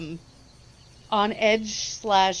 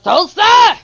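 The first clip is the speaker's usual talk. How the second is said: loud, high belly-powered call; authoritative yell; 11 semitones higher, 15 dB louder than usual. Acted. Caro calling out the door for Tulsa, authoritative yell. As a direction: throw the voice out from the belly. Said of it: "authoritative from the belly".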